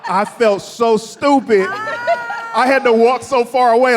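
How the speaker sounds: laughing it out, slow and loud; embarrassed laugh through the words. Real, not acted. Telling the crowd his awkward mistake, laughing.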